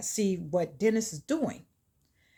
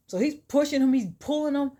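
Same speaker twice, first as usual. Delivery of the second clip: plain reading voice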